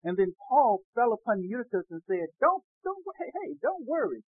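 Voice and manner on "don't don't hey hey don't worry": higher, quick-fire quoted reassurance; urgent reassurance. Acted. Preacher voices Paul to onlookers, urgent reassurance. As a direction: act out a hurried attempt to stop their worrying.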